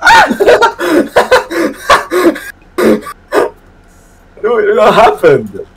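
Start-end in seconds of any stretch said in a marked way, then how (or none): 0.0-1.2 high startled scream. Real, not acted. Crash scream.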